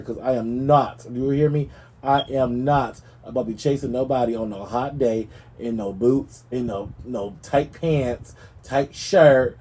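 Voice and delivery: ordinary talk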